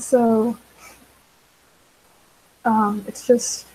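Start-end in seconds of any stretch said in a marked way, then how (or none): none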